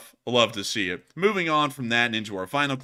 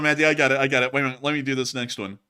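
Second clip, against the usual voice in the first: usual speaking voice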